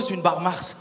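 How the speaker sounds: ordinary talk, nothing marked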